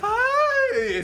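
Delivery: very high, loud shriek broken by laughter; a laughing mock scream; imitated scream. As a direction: shriek like someone caught in a nightmare, half laughing. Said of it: "¿Sabe cómo gritan?".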